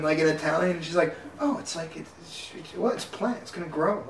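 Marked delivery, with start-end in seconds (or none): 1.4-4.1 softly voiced grandma making excuses; a quiet, halting little shrug of an answer; mild mock excuse. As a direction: lightly imitate a hushed, casual justification. Grandma excusing her theft, retold.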